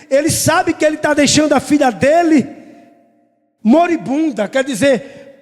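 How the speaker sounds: preaching. Acted. Preacher tells the church Jairo's story, fervent preaching. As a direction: lift it into a full pulpit telling.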